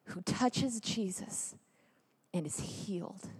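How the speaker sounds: soft, low and reverent; hushed storytelling. Real, not acted. Speaker recounting the gospel reading at Mass, hushed. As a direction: let it sink almost to nothing.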